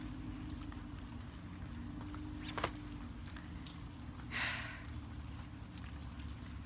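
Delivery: long breathy contented sigh; long dreamy sigh. Real, not acted.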